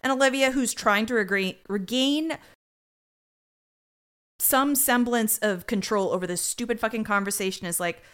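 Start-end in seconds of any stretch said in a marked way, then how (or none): none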